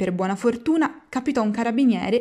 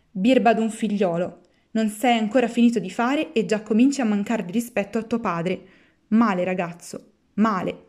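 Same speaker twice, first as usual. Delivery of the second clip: ordinary delivery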